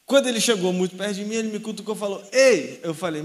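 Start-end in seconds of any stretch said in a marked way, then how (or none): 2.3-2.8 a sudden loud "Ei!" to grab attention; loud call. Retold or imitated. Drunk stranger poking him at the bus stop, loud call. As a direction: bark it to get his attention.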